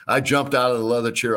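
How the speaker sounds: ordinary delivery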